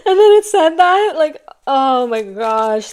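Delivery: real overwhelmed cry of feeling; emotional outburst. Real, not acted. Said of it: "I'm getting so emotional".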